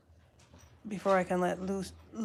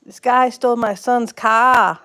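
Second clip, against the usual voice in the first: loud mock Boston accent, higher; 4 semitones higher, 12 dB louder than usual. Retelling a video of a Boston lady, loud mock Boston accent. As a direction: imitate the Boston woman complaining, with a loud Boston accent. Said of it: "she must have been from Boston".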